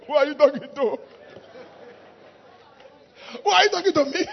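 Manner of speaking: loud, high mock outrage; indignant challenge. Offended church member grabbing someone who spoke to them, indignant. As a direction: fire it back as an offended challenge.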